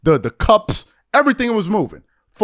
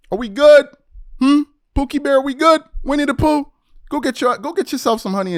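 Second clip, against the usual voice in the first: mocking taunt; 7 semitones higher than usual. Real, not acted. A vlogger teases a rival pundit, mock sweet taunting.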